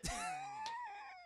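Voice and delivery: very high squealing laugh; high cackle. Real, not acted. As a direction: shriek with laughter. Laugh burst.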